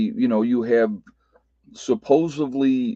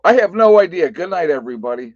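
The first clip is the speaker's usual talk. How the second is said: high, loud burst of pretend cluelessness; joking, over-the-top quick sign-off; mock sign-off; 7 semitones higher, 8 dB louder, faster than usual. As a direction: blurt it out high, then rush the goodbye. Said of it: "I was gonna come on and go".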